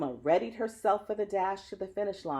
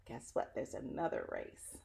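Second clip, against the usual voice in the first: soft teasing aside; 6 semitones lower, 10 dB quieter than usual. Real, not acted. Reader teases kids softly.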